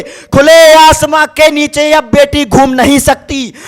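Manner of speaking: slow, drawn-out declamation; impassioned poem recitation. Acted. Poet voices the girl's indictment of humanity, impassioned recitation.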